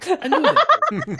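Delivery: high, loud, slow laughing gloat; laughing triumph. Acted. Gleeful vindication, laughing.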